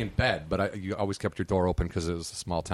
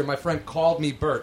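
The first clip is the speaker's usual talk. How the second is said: ordinary talk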